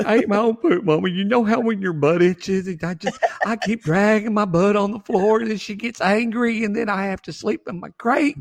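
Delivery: high, rushed whiny dog voice; golden retriever. Acted. Dog confessing gross habits to Mama, high whiny dog voice. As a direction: plead like an embarrassed pet dog. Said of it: "that golden retriever's sacrifice".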